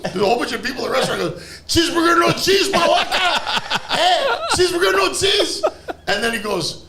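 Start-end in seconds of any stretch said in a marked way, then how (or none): 1.7-5.6 hammed-up shouting. Burger man to a whole restaurant, high mock shout. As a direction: holler it across a crowded room, pitch way up.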